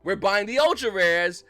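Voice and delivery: loud, slow, stretched-out mock proclamation; mock declaration. Players declaring a market buyout, loud mock declaration. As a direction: imitate hoarders announcing each buy loudly and slowly.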